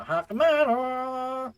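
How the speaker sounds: high, loud and very slow; dramatic announcement. Acted. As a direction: announce it like a movie title.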